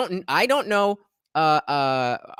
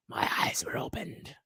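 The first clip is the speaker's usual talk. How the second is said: softer, hushed reveal; dramatic whisper; 9 dB quieter than usual. Acted. Streamer telling chat how his looks changed, hushed whisper. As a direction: breathe it like a secret. Punchline of growing-up story.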